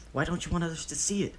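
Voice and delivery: put-on high little boy's question; curious child. A narrator's reading. Child Deidara suddenly asking Obito about his mask, high curious voice. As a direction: ask like a nosy kid. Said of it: "barrage of childish questions".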